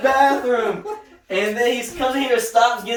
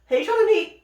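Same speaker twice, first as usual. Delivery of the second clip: sweet-talking Putin; 11 semitones higher, faster than usual. Pretend. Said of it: "waits one day later and says".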